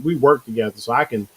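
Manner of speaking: ordinary delivery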